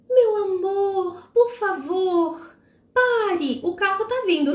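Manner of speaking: high, slow, sweet coaxing; overly gentle plea. Pretend. Mother sweetly pleading with runaway child, too gentle. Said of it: "Você não vai dizer".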